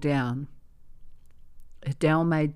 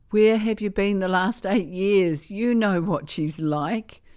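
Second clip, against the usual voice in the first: a little louder, pressing the question; raised voice; 5 semitones higher, 6 dB louder than usual. A narrator's reading. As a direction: voice lifted in reproach. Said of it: "Miriam raised her voice a little again".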